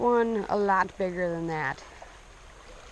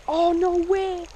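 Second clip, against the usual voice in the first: high, drawn-out amazement; real disbelief; 9 semitones higher, 4 dB louder, slower than usual. Real, not acted. Angler feels a fish on the line, amazed. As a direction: stretch it out high in astonishment.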